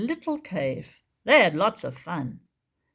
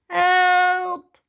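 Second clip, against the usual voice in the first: loud high shout for help; cry for help; 12 semitones higher, 8 dB louder than usual. Acted. Trapped young dinosaur crying out to the others, loud high shout. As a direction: yell it out, trapped. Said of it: "cried Tyro".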